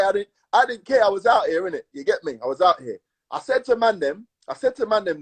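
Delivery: usual speaking voice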